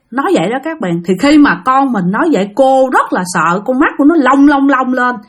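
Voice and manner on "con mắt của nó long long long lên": ordinary delivery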